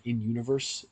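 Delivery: ordinary talk, nothing marked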